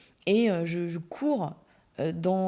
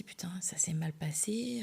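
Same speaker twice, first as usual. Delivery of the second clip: under the breath, a worried whisper; whispered thought; 8 dB quieter than usual. Retold or imitated. To herself after he walks off, a whispered worry. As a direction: whisper it to yourself, dismayed.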